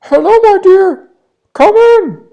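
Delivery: very high, loud put-on granny voice; a wolf squeaking like a sweet granny; wolf faking grandma. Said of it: "tried to imitate Grandma's small voice". (the wolf in bed faking grandma's voice)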